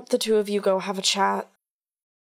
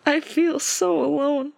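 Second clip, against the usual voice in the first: slow, higher, hollow grief; desolate, near tears; 6 semitones higher, slower than usual. Acted. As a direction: say it as if barely holding together. Grieving daughter after a sigh.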